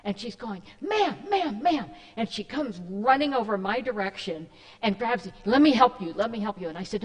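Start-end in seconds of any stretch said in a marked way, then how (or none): 0.8-1.9 imitating a frantic passer-by, high and loud. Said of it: "she's just frantic". 5.5-6.7 retold frantic offer of help, loud burst. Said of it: "she's just frantic".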